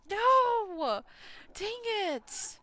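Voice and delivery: sudden high, loud cry of dismay; real frustrated outburst. Real, not acted. Gamer reacting to his family's hardship, real angry cry. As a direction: blurt it out, genuinely upset at the bad news. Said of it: "ugh, I'm so mad".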